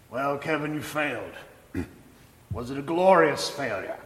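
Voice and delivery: put-on light Afrikaans-accented professor; a touch of South African Afrikaans accent. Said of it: "in his South African Afrikaans accent".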